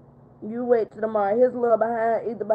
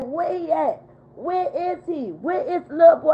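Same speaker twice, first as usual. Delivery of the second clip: high-pitched, insistent questioning; retold urgent demand; 7 semitones higher than usual. Grandmother retelling her hunt for the boy, urgently demanding. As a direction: re-enact demanding to know where he is.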